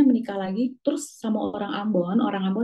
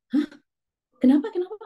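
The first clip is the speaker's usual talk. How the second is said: high startled "Hah? Kenapa?"; young man hearing his mother was killed, shocked; shocked disbelief; 6 semitones higher, faster than usual. Retold or imitated. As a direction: retell the son's jolt of shock, pitched up. Shocked son hears mother killed.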